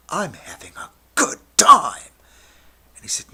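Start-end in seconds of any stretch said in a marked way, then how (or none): 0.1-2.1 high, loud, wildly carried away; exuberant protest. Retold or imitated. Tripping student protesting to teacher, high loud outburst.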